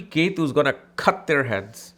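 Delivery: normal talking voice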